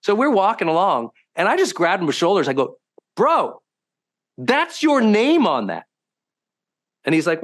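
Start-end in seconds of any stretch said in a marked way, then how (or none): 3.1-5.9 high, slow, pointed challenge to a friend; emphatic confrontation. Retold or imitated. Grabbing his bemoaning friend by the shoulders, emphatic confrontation. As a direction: stop him short, pitched up and drawn out.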